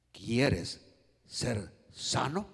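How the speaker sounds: low and drawn out, word by word; slow gentle question. Acted. Preacher voicing Jesus to the paralytic, slow low question. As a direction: pause between words, lift the last one. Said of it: "Cómo cree usted ... Jesús le dijo".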